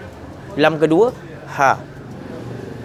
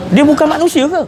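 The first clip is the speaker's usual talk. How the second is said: loud rhetorical challenge; 11 semitones higher, 4 dB louder than usual. Real, not acted.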